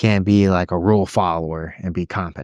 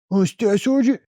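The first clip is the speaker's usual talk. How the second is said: much higher nervous mock voice; mock nervous peer; 13 semitones higher than usual. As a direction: squeak out the rank nervously. Mocking unsure peer.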